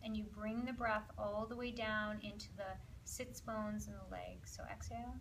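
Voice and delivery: hushed relaxation cue; guided breathing. Acted. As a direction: ease the class down into the hips and legs.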